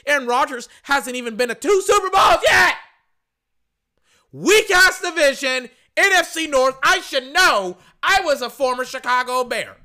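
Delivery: all-out angry shout about a rival quarterback; passionate shouting rant. Real, not acted. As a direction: yell it like a furious fan tearing into a rival. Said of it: "when I lose my voice about Tom Brady".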